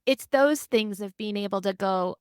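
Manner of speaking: ordinary delivery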